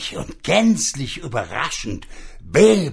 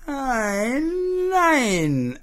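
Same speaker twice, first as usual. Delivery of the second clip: magic pig; 14 semitones higher than usual. Acted. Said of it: "antwortete das Zauberschwein".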